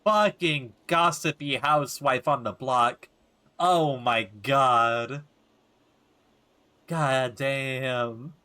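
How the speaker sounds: slow, drawn-out exasperation; exasperated disbelief. Real, not acted. Player reacts to gossipy housewife, slow and exasperated. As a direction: stretch every word in disbelief.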